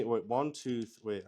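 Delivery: ordinary delivery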